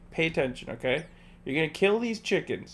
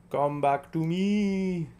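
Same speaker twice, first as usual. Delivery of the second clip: slow, stretched out come back to me; drawn-out call; 2 semitones higher, much slower than usual. Acted. Calling Cal back slowly, stretched out. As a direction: drag each word out like calling to a friend.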